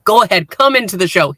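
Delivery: ordinary delivery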